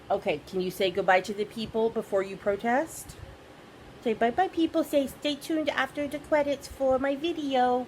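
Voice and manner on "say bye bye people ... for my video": cat voice. Pretend. Voicing the cat to viewers.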